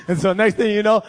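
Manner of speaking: usual speaking voice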